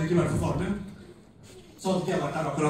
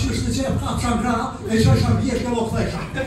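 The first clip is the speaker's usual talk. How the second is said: raised, hammed-up dad voice; imitating dad; 5 semitones higher, 5 dB louder than usual. Comedian retelling dad's boast, a bit higher. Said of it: "Jeg har sagt".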